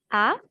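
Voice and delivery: "Ah" sweeping sharply upward; rising second tone. Shown as a demonstration. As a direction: answer "Ah?" as if someone called you. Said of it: "Le ton qui monte".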